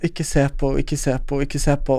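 soft, repeated self-coaching; retold inner muttering. Retold anxious thought, avoiding eye contact.

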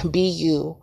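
ordinary delivery

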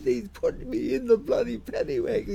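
put-on high, fast, whining drunk voice; drunk complaint. Drunk student complaining about the paddy wagon, high whine. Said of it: "He said".

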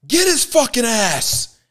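hammed-up stadium heckle; retold yell. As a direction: yell it like the rowdy fan in the stands. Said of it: "And I hear him say".

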